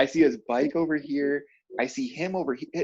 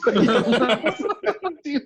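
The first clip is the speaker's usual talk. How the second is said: laughing; 12 semitones higher, 4 dB louder than usual. Real, not acted. Still laughing.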